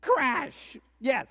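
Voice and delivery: ordinary talk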